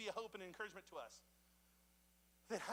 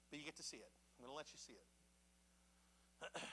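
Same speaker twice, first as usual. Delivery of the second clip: tender whisper; 4 semitones lower, 13 dB quieter than usual. Retold or imitated. God's gentle promise.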